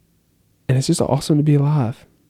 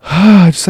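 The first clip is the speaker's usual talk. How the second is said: sigh; 8 semitones higher, 8 dB louder than usual. Real, not acted.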